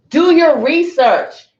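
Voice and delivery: loud, slow all-caps yell. Shown as a demonstration. Mocking a caps-lock post, loud slow yell. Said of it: "when I say it like that, you know they're yelling".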